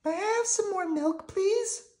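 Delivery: put-on very high, little-girl puppet voice; sweet falsetto child; Prairie Dawn. A narrator's reading. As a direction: ask politely in a tiny high squeak. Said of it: "said Prairie Dawn".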